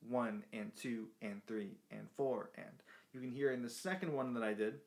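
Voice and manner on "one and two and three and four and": long-short lilt on each beat, slower; swung count. Shown as a demonstration. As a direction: lean on each number, clip each and. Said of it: "swung eighth notes".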